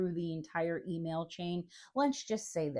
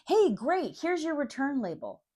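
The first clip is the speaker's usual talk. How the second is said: mock chipper reply; 8 semitones higher, 4 dB louder than usual. Company's instant cheery reply.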